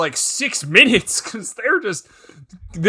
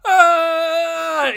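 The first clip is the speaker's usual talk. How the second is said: short high yelp of pain; a squeaky cartoon cry as he's stomped; mock scream; 12 semitones higher than usual. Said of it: "he goes".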